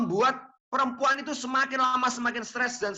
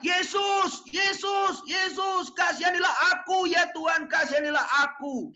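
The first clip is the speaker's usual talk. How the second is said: desperate shouting; 9 semitones higher than usual. Pretend. Canaanite woman shouting after Jesus, desperate cry. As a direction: call out to him as he walks away. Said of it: "Dia teriak-teriak".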